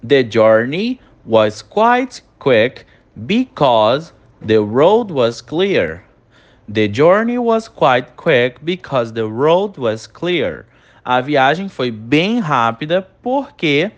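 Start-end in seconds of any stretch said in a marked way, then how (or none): 0.1-10.6 slow dictation. Shown as a demonstration. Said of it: "Olha a nossa próxima frase".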